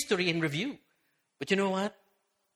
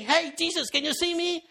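much higher, eager shout-out from the crowd; eager call; 10 semitones higher than usual. Retold or imitated. Zacchaeus waving from the crowd to Jesus, eager higher call. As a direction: wave and call to get noticed. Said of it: "he was waving".

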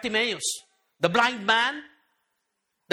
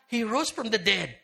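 normal talking voice

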